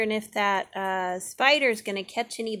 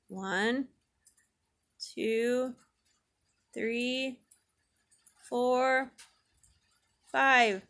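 slow counting; 2 semitones higher, much slower than usual. Shown as a demonstration. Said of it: "count five".

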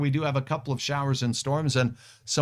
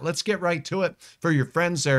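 normal talking voice

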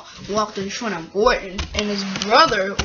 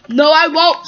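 loud, raised-pitch cross refusal; 5 semitones higher, 7 dB louder than usual. Acted. Gordon defies the brother's order, crossly. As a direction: dig in and refuse loudly. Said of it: "said Gordon crossly".

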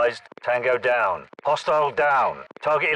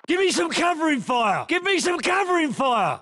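shouted callout; 7 semitones higher than usual. Acted. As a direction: yell it over the noise. Shouted take.

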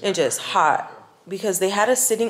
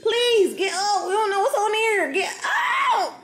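laughing high pleading; urging the dog up, laughing; 11 semitones higher than usual. Real, not acted.

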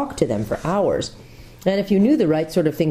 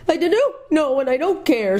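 sobbing; 12 semitones higher than usual. A narrator's reading. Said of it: "sobbed Jill".

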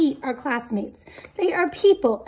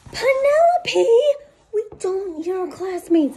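teacher scolding; 4 semitones higher than usual. Acted.

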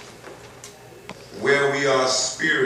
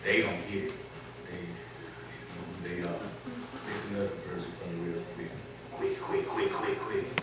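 quiet aside; 5 semitones lower, 11 dB quieter than usual. Real, not acted. Aside to helper, off mic.

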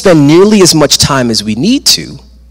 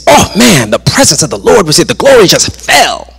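higher, quick, awed exclamation; excited worshipers; 7 semitones higher than usual. Retold or imitated. Worshipers exclaiming after the glory fell, excited. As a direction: imitate amazed churchgoers, pitch jumping up.

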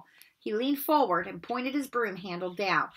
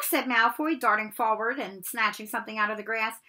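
plain reading voice

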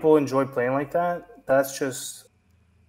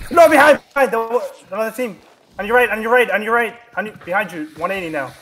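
urgent callout; 7 semitones higher, 6 dB louder than usual. Real, not acted. Urgent game callouts to teammate.

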